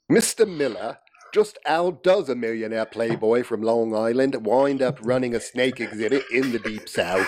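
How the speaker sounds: mock posh English accent; reading the Englishman's line in a put-on English accent. Shown as a demonstration. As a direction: read it as the bearded Englishman. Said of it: "Do the voice".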